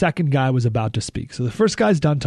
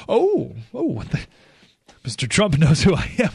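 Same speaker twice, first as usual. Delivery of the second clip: mock flattered surprise. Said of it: "I go".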